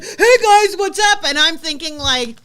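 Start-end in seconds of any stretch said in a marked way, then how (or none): 0.0-1.2 switching to a bright, loud on-camera greeting; mock upbeat vlogger hello, higher and louder; cheery host voice. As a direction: mimic his instant camera cheer. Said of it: "He's like".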